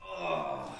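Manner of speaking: ordinary delivery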